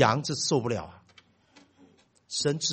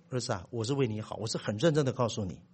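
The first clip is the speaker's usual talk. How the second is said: softer, earnest fatherly tone; sincere; 7 semitones lower, 9 dB quieter than usual. Retold or imitated. Father earnestly telling his son it's for his own good, soft sincere. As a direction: tell him gently, you mean it.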